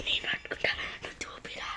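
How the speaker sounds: real whispered aside to a friend. Real, not acted. Kid whispers instructions to his friend holding the camera. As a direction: whisper so the viewers won't hear.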